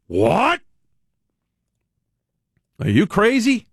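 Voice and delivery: high, incredulous outburst; mock-outraged disbelief; indignant disbelief. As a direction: react as if mortally insulted, pitch leaping up. Acted outraged reply to insult.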